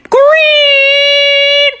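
drawn-out guess. Acted.

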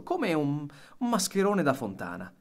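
ordinary delivery

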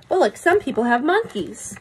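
ordinary delivery

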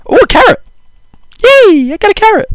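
very high, squeaky excited voice; squeaky delight. Pretend. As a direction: squeal with delight over a tiny find. Finds a carrot in game.

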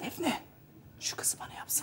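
very soft, secretive whisper; whispered aside. Acted. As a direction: whisper it so others won't hear. Discreet request about a girl.

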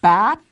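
"bat" with a clear, firm b; b sound. Shown as a demonstration. Teacher modelling "bat" for the class, crisp b. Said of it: "is gonna make the same sound as in".

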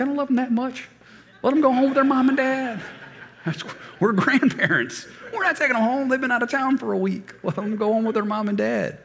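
choked-up grandpa, high and loud, refusing; crying. Retold or imitated. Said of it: "both driving out of the airport crying".

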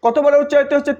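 ordinary delivery

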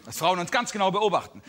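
ordinary talk, nothing marked

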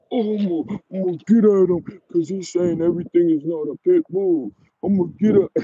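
high-pitched mock of riled-up men; mocking emotional chumps. Vlogger mocks men threatening him over pit bull talk, mocking. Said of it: "emotional chumps".

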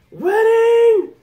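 very high, loud held yell; a long high screech; monster scream. Acted. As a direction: bellow it like a rampaging giant. Voicing Big Mom scream.

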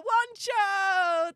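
high, loud greeting shout; excited holler; delighted name call. Real, not acted. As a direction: call out to a friend who just turned up. Greeting a returning supporter by name.

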